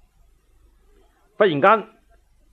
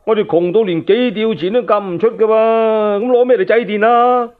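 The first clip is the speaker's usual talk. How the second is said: higher, louder anxious protest; a pauper blurting out his worry; poor man complaining; 5 semitones higher, 3 dB louder than usual. Acted. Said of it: "有人就話啦噃".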